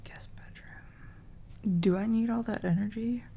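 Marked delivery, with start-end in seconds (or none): 0.0-1.8 whispered under breath; barely voiced, slow aside. Real, not acted. Whispering to self.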